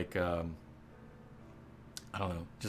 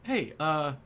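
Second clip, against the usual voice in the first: higher, eager cat barkeep spotting a regular; excited Tomcat bartender; 6 semitones higher than usual. Acted. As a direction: greet him brightly, pitched way up. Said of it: "he says".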